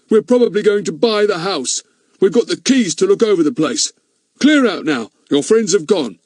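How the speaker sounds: loud, high, ordering him off; a brusque older man; stern man. A narrator's reading. Man ordering intruder away.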